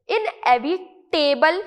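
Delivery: इनएविटेबल said with a full "table" at the end; wrong pronunciation. Shown as a demonstration. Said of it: "नहीं pronounce करना है". (इनएविटेबल के अंत में साफ़ "टेबल" बोलते हुए गलत उच्चारण)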